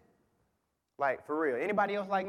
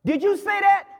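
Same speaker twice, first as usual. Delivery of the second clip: startled question; 11 dB louder than usual. Acted. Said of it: "he says to the parrot".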